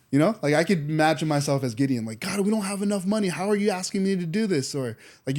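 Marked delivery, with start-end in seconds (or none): 2.2-4.7 higher-pitched, imagined complaint to God; acted-out frustrated questioning; exasperated protest. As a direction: plead in mock disbelief, pitch raised. Imagines himself as Gideon protesting to God.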